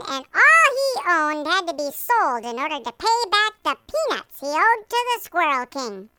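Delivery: normal talking voice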